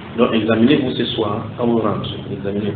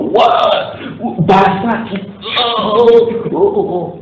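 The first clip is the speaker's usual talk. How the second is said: high, loud cheering of a football crowd; mock match-day roar, voice leaping up; imitated fan shouting; 10 semitones higher, 5 dB louder, much slower than usual. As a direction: cheer like a passionate stadium fan. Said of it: "crie comme ça".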